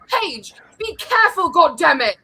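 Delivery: high-pitched imitation of an exasperated Nana; mock grandmotherly telling-off; Nana's scolding accent. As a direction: scold like your hard-to-understand Nana. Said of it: "you can't understand her".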